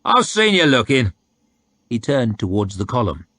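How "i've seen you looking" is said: teasing soldier. A narrator's reading.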